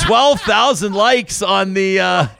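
pushed high, mock-suspicious; mock insinuation. Mock conspiracy theory, pitched up.

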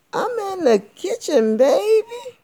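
very high, falsetto granny voice; cat croon it as a sweet old grandma; fake grandma. A narrator's reading. Cat disguised as Grandma calling her in. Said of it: "act like he's got a cold".

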